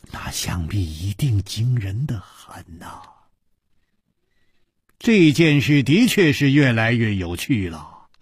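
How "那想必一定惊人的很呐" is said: far-gazing hushed murmur. A narrator's reading. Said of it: "缓缓地说".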